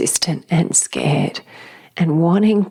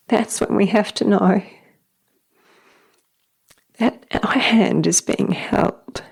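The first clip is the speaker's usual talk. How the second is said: higher voice, choked up with real tears; tearful; 5 semitones higher than usual. Real, not acted. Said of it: "sometimes I just can't stop crying".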